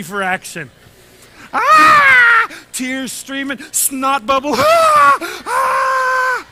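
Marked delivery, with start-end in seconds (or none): 1.5-2.5 very high, very loud scream; panicked scream. Retold or imitated. Lost boy screaming in freak out mode. 4.5-6.5 very high, very loud scream; panicked scream. Retold or imitated. Lost boy screaming in freak out mode.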